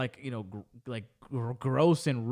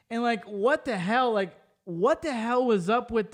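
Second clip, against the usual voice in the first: exasperated outburst; 6 semitones higher than usual. Real, not acted. Vlogger to her viewers about the Olympics, higher and exasperated. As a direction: pitch jumps up on the repeat, exasperated. Repeats louder in outrage.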